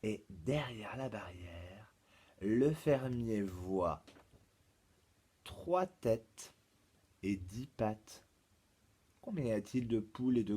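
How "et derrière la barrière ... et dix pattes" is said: measured delivery with long pauses between phrases; slow dictation. Shown as a demonstration. Teacher reads pupils a maths problem, slow dictation. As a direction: read the problem out slowly, phrase by phrase.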